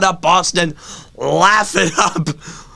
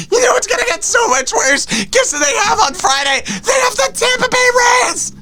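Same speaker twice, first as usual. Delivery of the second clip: mock sobbing wail. Real, not acted. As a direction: cry out in comic anguish. Comic despair wail.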